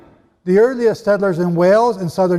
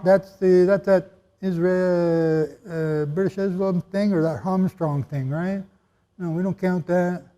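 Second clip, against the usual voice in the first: low, soft imitation of dismissive objectors; muttered mock objection; mock dismissal; 3 semitones lower, 5 dB quieter than usual. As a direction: wave it away quietly, as a doubter would. Mimics objectors dismissing the evidence.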